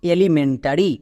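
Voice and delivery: Elementary blended into one word; joined-up pronunciation. Shown as a demonstration. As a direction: run the parts of elementary together. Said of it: "পুরো ওয়ার্ডের উচ্চারণ কি দাঁড়ালো".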